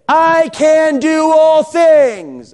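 high, stretched-out mock proclamation; hammed-up triumphant preacher cry; triumphant declaration. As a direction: belt out the verse slowly, high and grand. Acting out the half-finished verse.

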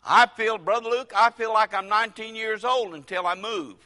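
usual speaking voice

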